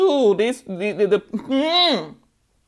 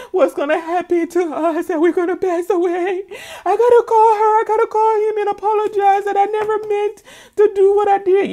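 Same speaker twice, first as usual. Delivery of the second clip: high, loud mock panic; panicked; 11 semitones higher, 4 dB louder than usual. Guilty couple panicking that they're caught. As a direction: squeal it like a frightened guilty person. Voicing panicked people in reading.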